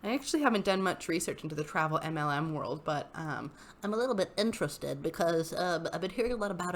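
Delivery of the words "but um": ordinary delivery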